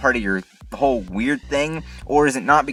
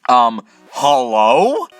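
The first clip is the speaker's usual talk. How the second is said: slow, louder, drawn-out hello with a cry; exasperated hello; 6 dB louder, much slower than usual. Real, not acted. Vlogger calls out to viewers, fed-up and drawn out. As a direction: stretch it out in fed-up disbelief.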